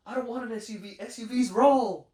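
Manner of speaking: high-pitched hammed-up refusal, swelling to a shout; mock protest. Retelling a TV dad refusing an SUV, mock high yell. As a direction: imitate an outburst, loud and drawn out at the end. Acting out show character's refusal.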